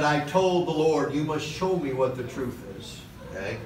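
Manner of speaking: normal talking voice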